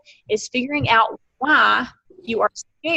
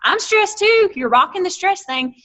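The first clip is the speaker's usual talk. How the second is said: sarcastic praise; 9 semitones higher, 5 dB louder than usual. Retold or imitated. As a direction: gush over it with fake cheer.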